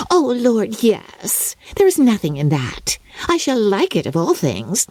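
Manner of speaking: put-on high, breathless chatter of a silly girl; Lydia, giddy young bride. Lydia boasting to her family, high and giddy. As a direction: gush it out like a spoilt bride.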